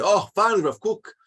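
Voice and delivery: high and loud, finally vindicated; eager relief. Retold or imitated. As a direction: cheer that the rabbi will act at last. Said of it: "And people will say".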